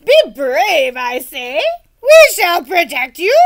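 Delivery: loud brave-sounding knight; rally her bravely; urging. Acted. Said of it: "the old knight urged".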